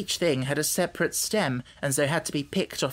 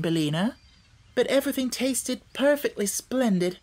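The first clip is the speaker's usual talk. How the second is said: put-on lightly raised girl's voice, earnest; a hint of a young girl explaining; little girl Dorothy; 5 semitones higher than usual. A narrator's reading. Dorothy explaining the new country to the hen, lightly girlish.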